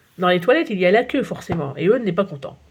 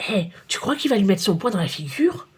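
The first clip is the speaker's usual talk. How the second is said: ordinary delivery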